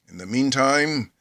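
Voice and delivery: usual speaking voice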